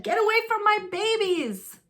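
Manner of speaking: high, fierce warning; mother duck defending her ducklings; protective mother. Acted. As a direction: shoo them off her babies. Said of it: "she said".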